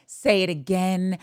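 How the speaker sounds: slow, fully voiced repeat of the line; savoured imitation. Fan replaying the bachelor's line, savoured slowly. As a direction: draw it out, relishing each word.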